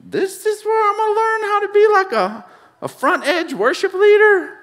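high, incredulous mocking question; sarcastic disbelief. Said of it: "look over me like".

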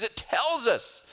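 high, fervent preaching build; rising preaching. Real, not acted. Pastor encouraging his church not to lose heart, fervent preaching. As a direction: lift the congregation's hearts.

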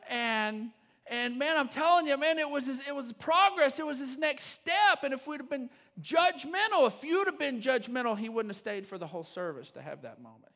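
loud, high, driving preaching; full-force preaching. Acted. Pastor urging his church not to judge, preaching at full force. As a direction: preach it hard to the room.

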